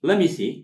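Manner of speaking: "Let me see" run together, t dropped; linked words. Shown as a demonstration. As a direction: say "Let me see" quickly as "lemme see", high and loud. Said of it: "ክልተ ቃል ጥዕም".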